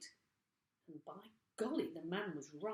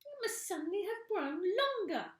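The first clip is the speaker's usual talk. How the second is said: put-on high puzzled old woman; 13 semitones higher, 6 dB louder than usual. A narrator's reading. Mrs Twit staring at her stick, high and anxious. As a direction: wonder aloud in a high worried voice.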